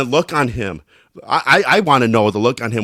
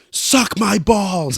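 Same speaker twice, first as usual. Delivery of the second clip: higher and very slow, stretched insult; drawn-out mock yell; 8 semitones higher, much slower than usual. Shouting a prank into a teammate's voice text, drawn-out. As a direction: holler it long for the mic to catch. Yelling into friend's talk-to-text.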